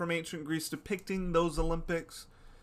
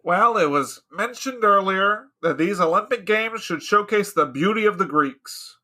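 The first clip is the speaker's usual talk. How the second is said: loud, declaiming senator; orating Socrates; 4 semitones higher, 10 dB louder than usual. Acted. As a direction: orate to the council. Acted committee debate, Socrates speaking.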